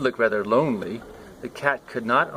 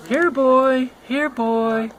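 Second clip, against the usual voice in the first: high, loud call across the yard; calling the dog; 14 semitones higher, 5 dB louder, much slower than usual. Acted. Child calling the dog from afar, high and loud. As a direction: shout for the puppy.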